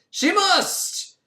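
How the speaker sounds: hammed-up dramatic. Dramatic narration of compulsion.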